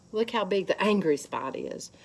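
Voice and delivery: ordinary talk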